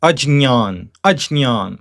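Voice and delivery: Ajnyan with j before ny, slowly; Ajnyan, j-n-y cluster; grammarians' pronunciation. Shown as a demonstration. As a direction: say Ajnyan with a clear j. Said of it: "should be pronounced just like this".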